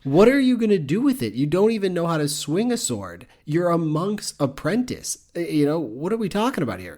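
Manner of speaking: high-pitched, incredulous telling-off; exasperated scolding. Pretend. Podcaster scolds Matthias over the sword, exasperated.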